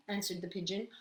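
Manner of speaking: ordinary delivery, nothing marked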